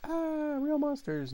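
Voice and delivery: high-pitched croaky cartoon voice; cartoon title imitation. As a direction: squeeze out a high cartoon-monster voice.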